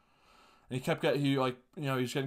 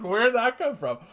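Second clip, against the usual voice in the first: high, loud, amused disbelief; loud stunned question; 10 semitones higher, 7 dB louder than usual. Retold or imitated. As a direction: blurt it out, floored and laughing. Shocked reaction to confession.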